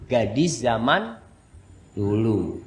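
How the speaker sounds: ordinary talk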